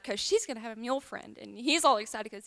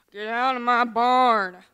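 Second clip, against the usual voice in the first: slow, loud growl of an order from a mule; grumpy mule; 2 semitones lower, 5 dB louder, slower than usual. Acted. Talking mule warns a lost old lady off, grumpy and loud. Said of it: "Why are you so grumpy".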